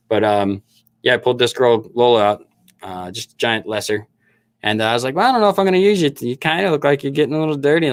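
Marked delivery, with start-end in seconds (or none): 5.1-7.9 higher, playful retold chat to a pet; lightly cooed pet talk. Talking to Lola as he pulled her out, lightly playful. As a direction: lift the voice as if sizing up a pet. Retells talking to his animal.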